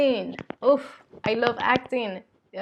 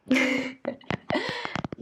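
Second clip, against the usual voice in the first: a quick high-pitched giggle; 9 semitones higher, 4 dB quieter than usual. Real, not acted. Laughs before next fact.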